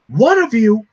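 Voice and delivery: high, loud offended echo; indignant retort. Acted. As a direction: throw the words back at him. Terry offended by the agent.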